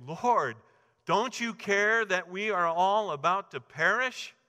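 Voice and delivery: high, loud desperate cry over the storm; panicked plea. Acted. Disciples shouting to Jesus in the storm, frantic and loud. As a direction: beg him to wake up and save them.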